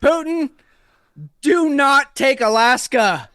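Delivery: high, loud, slow stern command; hammed-up president shouting down Putin; mock presidential warning. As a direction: bellow the order at Putin. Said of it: "My presidential impersonations".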